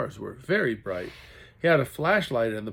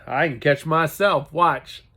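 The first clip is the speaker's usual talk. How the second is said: bragging; 3 semitones higher, 8 dB louder than usual. A narrator's reading. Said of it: "the little boy bragged".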